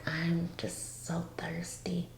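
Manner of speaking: slow, faint whisper; weak thirsty whisper. Retold or imitated. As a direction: whisper it slowly, like a drained patient. Retells weak patient asking for water.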